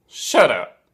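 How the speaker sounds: loud, punchy mock "shut up"; loud camp exclamation. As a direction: fire it off as a showy catchphrase. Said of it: "[name] sat there every now and then just going".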